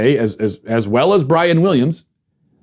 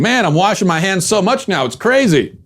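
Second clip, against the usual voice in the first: mock-amazed complaint, higher pitched; imitating social media posters; 7 semitones higher than usual. Host mimics people on social media about handwashing, mock amazement. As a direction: put on a poster marvelling at themselves. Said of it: "social media saying".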